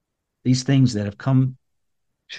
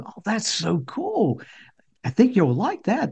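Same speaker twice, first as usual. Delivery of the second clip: put-on dad cooing to child; 8 semitones higher, slower than usual. Dad admiring his little son's gift, cooing.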